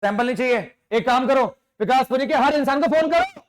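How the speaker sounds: angry boss refusing the sample. Acted. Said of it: "मैं गुस्से वाला बॉस हूं".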